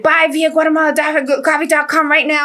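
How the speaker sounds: high-pitched, excited command; retold urgent order. Storyteller telling her graphic designer to act at once, urgent. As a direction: fire it off urgently.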